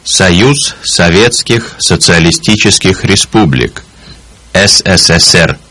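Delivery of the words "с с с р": ordinary talk, nothing marked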